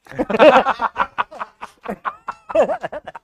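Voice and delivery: loud high burst of laughter; big laugh. Real, not acted. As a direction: let the laugh explode. Risa tras el chiste. (carcajada fuerte y aguda)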